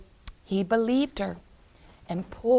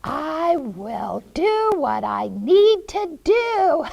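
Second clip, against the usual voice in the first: higher, louder witch voice; scheming witch; 7 semitones higher, 5 dB louder than usual. Acted. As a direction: gloat like a storybook witch. Witch voices her sinister plan.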